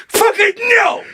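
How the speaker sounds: high-pitched hammed-up shout; enraged dad. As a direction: explode like a dad at a thieving gull. Dad snaps at seagull.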